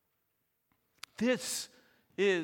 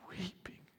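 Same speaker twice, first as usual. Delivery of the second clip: whispered weeping; 15 dB quieter than usual. Shown as a demonstration. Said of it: "This was weeping".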